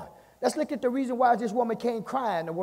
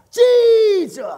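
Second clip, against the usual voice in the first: loud, high, drawn-out cry for help; long pleading holler that falls away at the end; desperate shout; 12 dB louder than usual. Acted. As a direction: shout after someone far ahead, holding the vowel. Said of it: "She's falling behind the answer".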